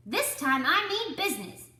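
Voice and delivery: high, loud, firm warning; stern anger. Acted. Duck warns the animals, high loud and stern. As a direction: lay down the law.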